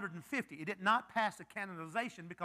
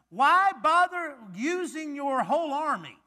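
taunting giant; 6 semitones higher, 8 dB louder than usual. Acted. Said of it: "he's taunting him".

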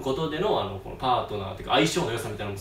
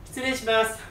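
imitating the secretary; 7 semitones higher than usual. Secretary enters Eri's office.

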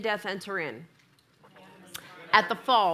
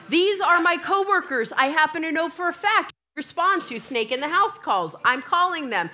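insistent retort; 6 semitones higher, 4 dB louder than usual. Retold or imitated. Insisting to husband on phone.

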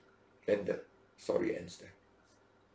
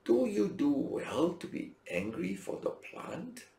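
gentle God; 9 semitones higher, slower than usual. Acted. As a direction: ask it gently and slowly. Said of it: "but God said to Jonah".

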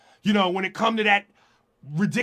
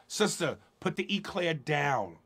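soft mock scolding; 4 semitones lower, 8 dB quieter than usual. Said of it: "be real and go".

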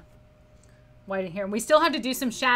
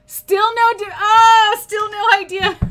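real laughter, loud and high, through the punchline; high loud laughing words; bursting laugh; 10 semitones higher, 13 dB louder, slower than usual. Real, not acted. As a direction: crack up loudly mid-sentence. Cracking up at the riddle.

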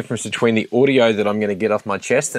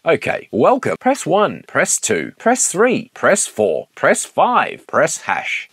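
higher, polished automated menu read; phone menu voice; 6 semitones higher, slower than usual. Shown as a demonstration. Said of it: "one more take".

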